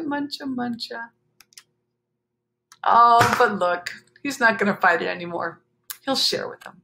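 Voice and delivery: plain reading voice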